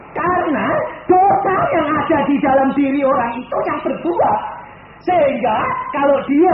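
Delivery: loud, high preaching with a crying edge; tearful preaching. Acted. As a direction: plead it through tears. (berkhotbah dengan suara menangis)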